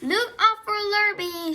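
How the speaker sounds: sustained high warning cry; called warning. A narrator's reading. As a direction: call out a warning across the yard. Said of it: "called the".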